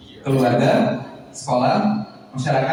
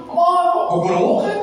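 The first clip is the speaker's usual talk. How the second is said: mock yelling; 13 semitones higher, 4 dB louder than usual. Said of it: "asup gorowokan".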